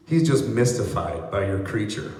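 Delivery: normal talking voice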